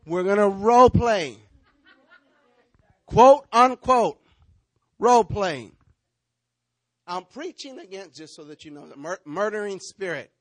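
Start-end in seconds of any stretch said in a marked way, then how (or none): 0.0-1.4 big, loud preacher's announcement. Real, not acted. Preacher announcing a role play to the congregation, loud. 3.0-5.7 slow, loud quote-unquote; loud, slow emphasis. Real, not acted. Preacher stressing the role play to the congregation, loud and slow.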